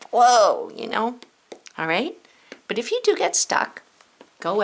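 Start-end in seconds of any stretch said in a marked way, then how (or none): 0.1-0.7 excited. Shown as a demonstration. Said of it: "something that's a lot".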